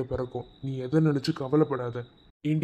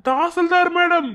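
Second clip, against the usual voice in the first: teasing greeting; 13 semitones higher, 6 dB louder than usual. Acted. Husband mockingly welcoming the Tahsildar madam, in a high sing-song voice.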